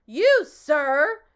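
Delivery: loud, high excited outburst; excited shout. Acted. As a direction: shout the accusation in a frenzy. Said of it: "shouted the bee very excitedly".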